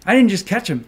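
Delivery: triumphant, can't contain the thrill of catching them; real excitement. Real, not acted. Streamer catching regulators admitting it, in real excited glee. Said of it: "I'm so fucking excited".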